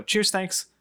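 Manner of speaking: high, polite British thanks; put-on British accent. Recalling England to the co-host in a British accent. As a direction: reenact your own British accent abroad. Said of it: "but I would always go".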